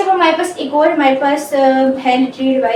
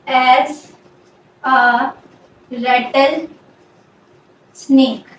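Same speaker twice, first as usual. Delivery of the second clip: one word at a time; long gaps between words; slow dictation; much slower than usual. Shown as a demonstration. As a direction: spell out the sentence word by word. Dictating example while writing it out.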